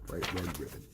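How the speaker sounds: ordinary delivery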